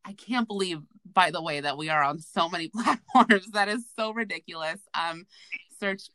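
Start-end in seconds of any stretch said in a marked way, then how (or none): none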